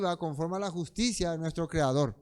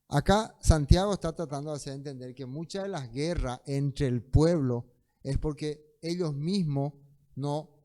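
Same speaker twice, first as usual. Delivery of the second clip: ordinary talk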